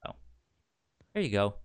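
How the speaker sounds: ordinary talk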